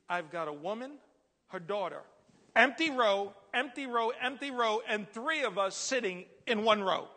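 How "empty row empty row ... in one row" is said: loud, high, exasperated listing; retold annoyance. Crowded passenger complaining about empty rows, loud mock annoyance. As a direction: hammer each repeat in mock exasperation. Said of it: "I was getting a little bit annoyed".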